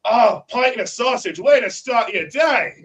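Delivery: high, slow, cheerful approval; cheery Aussie. Retold or imitated. Australians approving a morning pint, high, cheerful. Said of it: "they're like".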